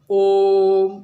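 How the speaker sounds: ओम with the vowel long drawn. Shown as a demonstration. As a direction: stretch the ओम long and loud. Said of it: "वह खींच कर बोलते हैं ओम".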